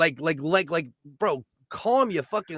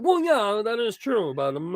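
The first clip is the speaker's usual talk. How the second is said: mock Jordan Peterson; 4 semitones higher than usual. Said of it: "He's like".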